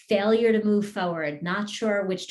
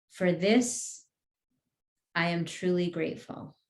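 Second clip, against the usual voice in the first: guided meditation. Acted. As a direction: lead the group gently, word by word. Leads guided healing affirmations.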